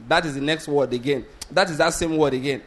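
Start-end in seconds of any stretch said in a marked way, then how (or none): none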